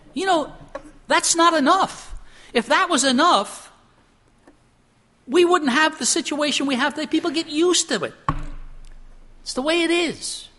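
high, forceful pulpit exclamation; emphatic preaching with pauses between phrases; impassioned preaching. Real, not acted. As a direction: drive the point home, pitch way up.

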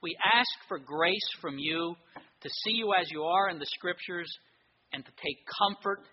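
earnest, rising pulpit prayer; fervent prayer. Acted. Pastor praying over his church, fervent and earnest. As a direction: pray aloud for the congregation.

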